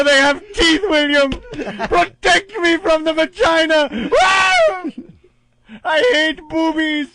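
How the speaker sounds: shrill hysterical shrieking ham; hammy stage actor. Pretend. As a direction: scream like a melodramatic coward. Parodying Doctor Smith in hysterics.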